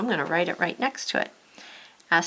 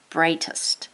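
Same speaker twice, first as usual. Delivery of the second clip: whispered word; 2 semitones lower than usual. Shown as a demonstration. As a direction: breathe the word out without voice. Teacher models word aloud.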